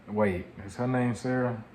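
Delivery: ordinary delivery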